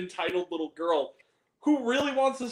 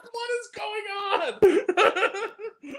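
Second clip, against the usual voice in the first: much higher, very slow, laughing bewilderment; baffled disbelief; 13 semitones higher, much slower than usual. Retold or imitated. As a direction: stretch it out, laughing in confusion.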